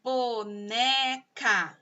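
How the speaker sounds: "Boneca" very slow, in three stretched syllables. Shown as a demonstration. Teacher counting three sounds for pupils, "Boneca" syllable by syllable. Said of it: "Boneca tem três sons".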